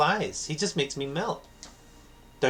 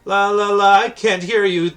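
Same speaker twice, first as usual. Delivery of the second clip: put-on loud, higher childish singsong; 9 semitones higher, 10 dB louder than usual. [name] drowning out friends' teasing, loud singsong. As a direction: drown them out like a kid.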